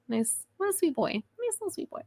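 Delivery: gushing over her sweet husband, cooing. Acted. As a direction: coo it like to a pet.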